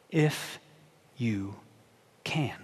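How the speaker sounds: If, you, can, each word set apart and soft; slow, hushed emphasis. Shown as a demonstration. Preacher savoring the father's doubt, hushed and slow. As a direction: let each of the three words hang. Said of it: "Three little words, so important".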